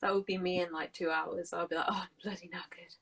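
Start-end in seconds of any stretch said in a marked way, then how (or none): 1.9-2.9 softly sighed, worn-out mumble; mock exhaustion. Imagining herself two hours from now, exhausted and hushed. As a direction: act worn out, almost voiceless.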